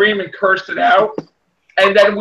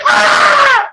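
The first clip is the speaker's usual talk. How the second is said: high-pitched yell of mock panic; mock terrified scream; 4 dB louder than usual. Imitating someone fleeing a ghost through the window, high mock scream. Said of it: "somebody's jumping out the window, but like".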